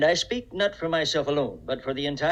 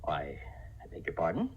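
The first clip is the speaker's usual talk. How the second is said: taken aback; 10 semitones lower, 11 dB quieter than usual. Acted.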